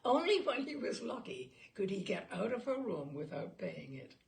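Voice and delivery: usual speaking voice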